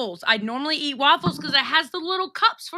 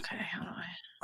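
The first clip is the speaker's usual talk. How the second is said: unvoiced whisper to self; 7 semitones lower, 20 dB quieter than usual. Real, not acted. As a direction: whisper it while fiddling. Muttering while setting up.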